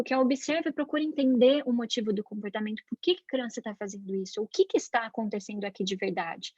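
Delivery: normal talking voice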